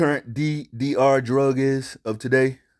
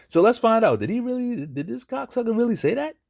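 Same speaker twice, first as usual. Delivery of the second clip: incredulous; 11 semitones higher than usual. Real, not acted. Shocked reaction to the clip.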